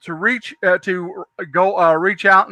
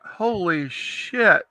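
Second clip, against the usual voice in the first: very slow, drawn-out amazement; softer, lingering disbelief; retold stunned awe; 5 dB quieter, much slower than usual. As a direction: stretch it out as if stunned. Retold stunned first sight of store.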